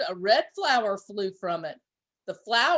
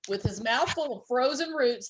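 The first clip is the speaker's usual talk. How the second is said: plain reading voice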